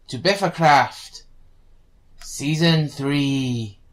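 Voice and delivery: slow drawn-out reveal; dramatic announcement. Acted. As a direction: announce it grandly, slowly.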